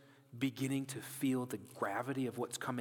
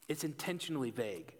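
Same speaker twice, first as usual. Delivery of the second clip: ordinary talk, nothing marked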